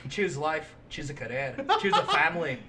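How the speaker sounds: Trainspotting-style Scottish monologue; mock Scottish film narration; Scottish accent. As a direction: recite it in an attempted Scots accent. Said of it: "My Scottish comes from the Trainspotting".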